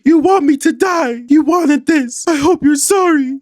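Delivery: very high, loud, slow accusing wail; mock hysterical shout. Acting Heather screaming at Louis, very high and loud. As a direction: scream it like she's losing her mind. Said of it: "Heather comes back saying".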